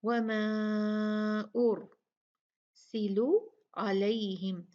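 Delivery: slow, drawn-out melodic tajweed recitation; measured Quranic chant. Shown as a demonstration. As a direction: recite it slowly, stretching the vowels.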